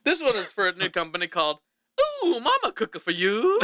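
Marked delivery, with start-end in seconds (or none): 2.2-3.6 comedic ad voice. Acted. Fake ad voice for a product.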